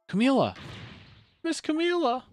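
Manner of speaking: calling out as Missile. Acted. Missile calling to Camila, high and anxious. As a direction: call her name, anxious.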